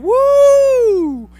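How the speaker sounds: a sudden high, loud whoop; high loud woo. Real, not acted. Exclamation about kids.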